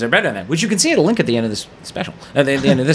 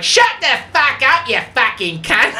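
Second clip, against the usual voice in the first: high, drawn-out heckler yell; mock crowd yell; 11 semitones higher than usual. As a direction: imitate an outraged drunk yelling someone down. Drunk crowd yelling at the heckler.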